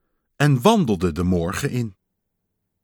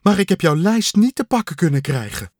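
panting; 6 semitones higher, faster than usual. A narrator's reading. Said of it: "hijgde Kikker".

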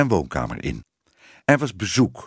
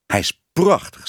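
higher, emphatic praise; admiring; 7 semitones higher, 4 dB louder than usual. A narrator's reading. Tibbe admires the child's drawing. (bewonderend, met nadruk)

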